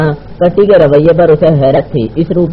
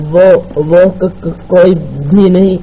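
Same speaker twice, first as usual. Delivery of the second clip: slow, higher-pitched nervous stutter; stammering; 4 semitones higher than usual. Acted. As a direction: trip over the first sounds while denying it. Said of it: "کٹی ہکلائی". (ہکلاتے ہوئے)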